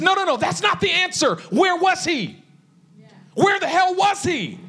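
high, urgent cry of objection; insistent challenge. Retold or imitated. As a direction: cut in high and demand the real answer. Retold challenge to a wrong answer.

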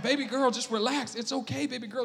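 put-on calm your little girl down; soothing daughter. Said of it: "And I'm like".

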